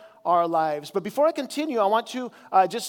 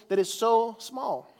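ordinary delivery

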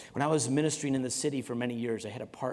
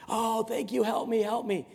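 high, pleading mock voice; high-pitched, eager plea; relieved cry for help; 10 semitones higher than usual. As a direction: act a desperate kid begging to be saved. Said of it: "he's yelling for help".

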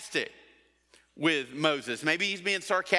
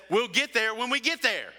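a hint of an exasperated dad, pitched higher; dad brushing it off; 6 semitones higher than usual. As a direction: toss it off like a tired dad at the wheel. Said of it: "And Dad says".

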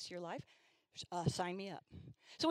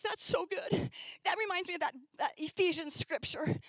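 high, bright exclaim; mock excited seventh grader; excited teen; 8 semitones higher than usual. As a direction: gush with sudden delight. Girl excited about scripture.

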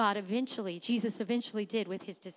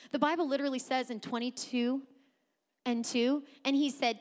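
normal talking voice

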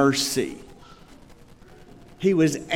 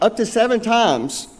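proud of his own generous offer, a touch higher; smug generosity; 6 semitones higher than usual. Retold or imitated. Pastor mimicking Peter's proud offer, mildly smug. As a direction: say it like a big favour. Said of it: "he thinks he's being generous with that amount".

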